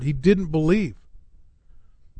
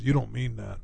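softer gentle reply; 6 semitones lower, 11 dB quieter than usual. Retold or imitated. Pastor answering angry friend.

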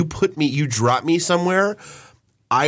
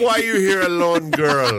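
hammed-up stand-up impression, pitched up; mock the line like a comic on stage; Eddie Murphy; 5 semitones higher than usual. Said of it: "I feel like listening to Eddie Murphy Raw".

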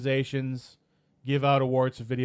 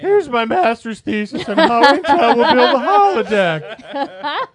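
mock pompous; 10 semitones higher, 10 dB louder, slower than usual. Joking about a long article.

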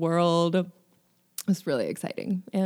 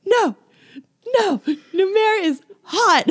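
high, loud wail of objection; hammed-up dismay; indignant protest; 13 semitones higher, 7 dB louder than usual. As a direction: protest it like an outraged fan. Mock-outraged protest at miscast voice.

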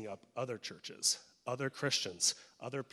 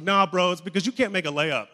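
louder, teasing put-down; loud mock retort; 5 semitones higher, 11 dB louder than usual. Middle school friends tease Eric about basketball, loud and mocking. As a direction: shout it back at him, mocking.

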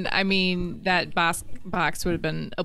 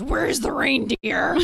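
high, slow, unhinged mom voice; crazy voice; 8 semitones higher, 4 dB louder, slower than usual. Retold or imitated. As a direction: demand it like a flustered madwoman. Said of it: "really sort of crazy voice".

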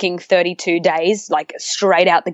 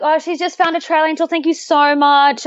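bright, high fake-chatty phone voice; pretend phone call; 10 semitones higher than usual. Pretending to talk to Mum to dodge a driver, bright and high. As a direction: act busy on the phone with Mum. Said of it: "I grabbed my earpiece".

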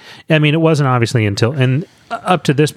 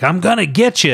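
playful villain taunt; 7 semitones higher than usual. Retold or imitated. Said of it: "she's, like, running around chasing them".

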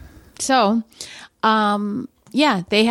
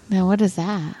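ordinary talk

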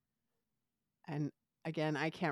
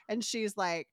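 usual speaking voice

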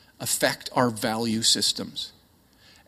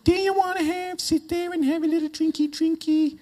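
put-on high-pitched baby talk. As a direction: coo as if to a baby. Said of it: "they kinda do this high-pitched, kind of treat 'em like a baby thing".

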